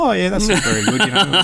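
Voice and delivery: high, laughing fake sympathy; stifle a laugh while playing along; playing dumb. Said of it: "Just played dumb".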